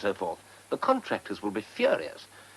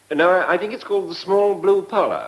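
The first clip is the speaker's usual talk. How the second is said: flustered correction; 6 semitones higher, 10 dB louder than usual. Acted. Aide corrects himself, flustered.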